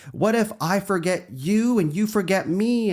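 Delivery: put-on baby Dory. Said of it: "she's like".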